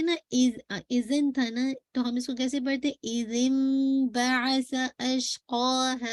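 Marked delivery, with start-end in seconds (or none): 3.0-6.1 slow recited इज़िम बअस अशक़ाहा with the m sound; careful tajweed demo of इज़िम; correct reading. Shown as a demonstration. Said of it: "ये पढ़ते हैं ना".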